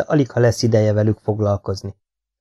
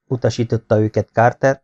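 usual speaking voice

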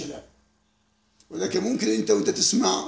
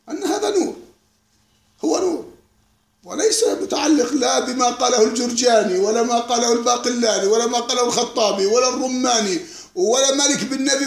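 forceful preaching; 4 semitones higher, 6 dB louder than usual. Real, not acted. Emphatic preaching list of scholars.

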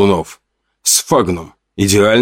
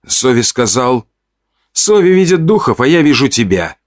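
mildly snappish, higher-pitched retort; snapping back; 5 semitones higher than usual. A narrator's reading. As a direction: snap back with raised pitch. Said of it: "огрызнулся Белк".

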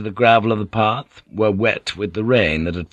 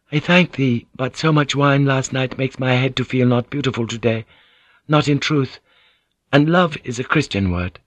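higher-pitched voice for a courteous lady; Mariko, gently demurring; 5 semitones higher than usual. Acted. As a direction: lift the pitch, politely deflect the compliment. Narrator voices Mariko in dialogue.

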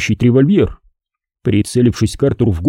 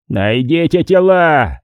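drawn-out, raised-pitch command; mild roar; 5 semitones higher, slower than usual. A narrator's reading. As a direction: stretch the order into an understated roar. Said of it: "взревел Харрисон". (сдержанный рёв, протяжно и выше обычного)

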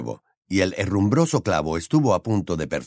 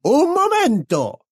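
very high, drawn-out cry; imperious witch's outburst; Bellatrix interrupting; 5 dB louder, slower than usual. A narrator's reading. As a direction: cut in sharply with a high, stretched shriek. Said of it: "saltó Bellatrix".

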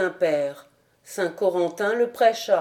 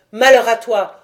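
much louder booming condemnation; thundering curse; 8 dB louder than usual. Acted. The saint curses the king from the rock, thundering. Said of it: "la voix tonnante du saint".

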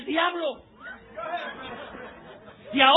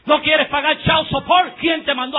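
preaching shout; 4 semitones higher, 9 dB louder than usual. Acted. Sermon scolding fathers.

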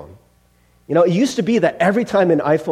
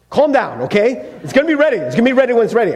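higher, snappy impatient parent; antsy; 4 semitones higher, faster than usual. Acted. Parent answering hungry kids about dinner, antsy and impatient. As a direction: brush off nagging kids, on edge. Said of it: "I started getting, like, antsy".

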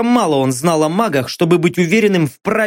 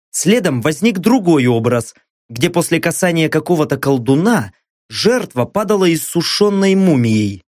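ordinary delivery, nothing marked

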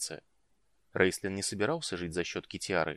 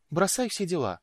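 higher-pitched urgent order; breathless urging; 10 semitones higher, 4 dB louder than usual. A narrator's reading. Woman rushes in to fetch the mage, urgent and excited. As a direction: urge them to drop everything. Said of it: "выдохнула она".

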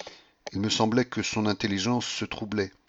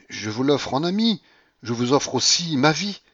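higher, louder, impassioned offer; fervent and pitched up; passionate plea; 6 semitones higher, 4 dB louder than usual. A narrator's reading. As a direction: plead with whole heart. Narrator's passionate offer to Paulina.

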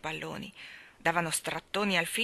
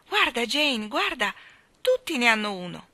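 eccitato; 6 semitones higher, 5 dB louder than usual. A narrator's reading. Michael chiama la sorella.

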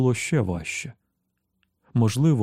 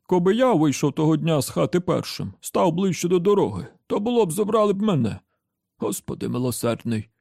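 put-on high-pitched old grandad recollection; grandfather character; 7 semitones higher than usual. A narrator's reading. Grandfather reliving his own narrow escape, high old-man voice. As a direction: play the old grandfather, pitched up, then dropping for the invocation. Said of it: "часто розповідав їм дід".

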